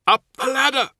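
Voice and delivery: high, loud shouted command; shouted order. Acted. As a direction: yell the order to the squad.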